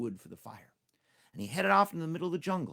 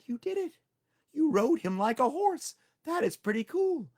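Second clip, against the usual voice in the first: higher, eager crowd of animals; amazed animals; 12 semitones higher, 5 dB louder than usual. Acted. Animals praising Tricky, higher excited voices. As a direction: cheer the hero.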